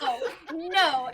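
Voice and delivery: loud, drawn-out mock refusal; exasperated refusal. Wife refusing husband's second joke, loud exasperated no.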